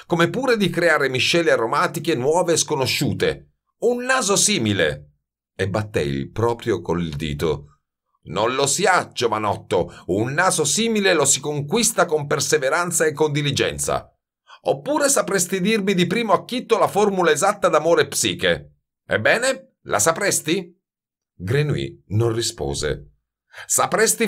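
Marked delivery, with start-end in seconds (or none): 0.1-5.1 put-on lecturing old master, higher pitch; lofty perfumer holding forth on the nose; haughty master perfumer. A narrator's reading. Master lecturing his young visitor on noses, haughty. 8.2-20.8 lightly voiced challenging master; haughty master perfumer. A narrator's reading. Master challenges the youth to give the formula, haughty.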